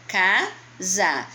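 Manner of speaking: "Casa" split into two syllables, ca-sa. Shown as a demonstration. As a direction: say "Casa" as two separate beats, ca, sa. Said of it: "de acordo com o número de sílaba". ("Casa" separado em sílabas, ca-sa)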